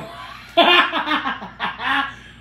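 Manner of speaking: a high, loud burst of real laughter. Real, not acted. Cook laughing at her mom's teasing, loud and high.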